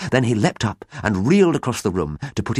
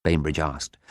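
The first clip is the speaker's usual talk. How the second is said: ordinary delivery, nothing marked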